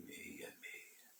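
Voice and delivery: barely audible whisper; hushed whisper. Real, not acted. Preacher reveals a feared number to listeners, whispered. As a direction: drop right down to a whisper.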